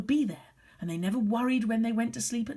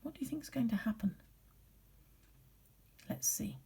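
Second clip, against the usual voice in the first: suspenseful whisper; 2 semitones higher, 7 dB quieter, faster than usual. Acted. Teases the class before the twist.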